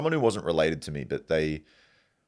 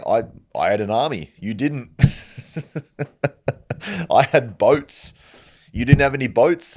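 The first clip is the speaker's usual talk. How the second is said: loud, higher boasting taunt; gloating conqueror; 7 semitones higher, 8 dB louder than usual. Acted. The victor taunting his broke opponent, loud and gloating. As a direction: rub it in like a smug warlord.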